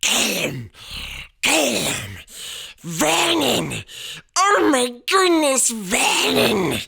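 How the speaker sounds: high, loud panicked cries; panicked wife. A narrator's reading. Wife crying out to her husband in panic, shrill. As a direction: shriek his name in alarm.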